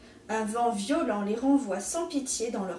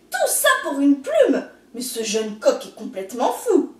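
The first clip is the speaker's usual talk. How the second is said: loud, strangled outrage; choking in disbelief; 2 semitones higher, 6 dB louder than usual. A narrator's reading. Snake appalled by the feather quest, loud disbelief. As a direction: splutter it out, aghast. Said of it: "s'étrangle le serpent".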